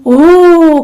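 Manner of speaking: a loud, held u from the chest; chest u vowel. Shown as a demonstration. Coach leading the exercise, loud chest vowel. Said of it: "haz lo siguiente".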